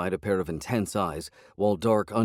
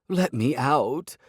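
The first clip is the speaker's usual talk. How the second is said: higher, slow demand rising; petulant cat; 6 semitones higher, 4 dB louder, much slower than usual. A narrator's reading. Cat demands release from her carrier, slow and higher. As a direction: whine it out, stretched.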